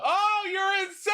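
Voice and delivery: high, loud shout of mock disbelief; playful high-pitched shout at a friend; joking outraged shout. Real, not acted. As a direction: yell it like he's lost his mind.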